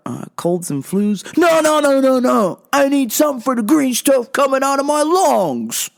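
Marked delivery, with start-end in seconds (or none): 1.4-5.9 loud, higher irate customer voice. Retold or imitated. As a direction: protest over the clerk, indignant and insistent. Acted irate customer protesting.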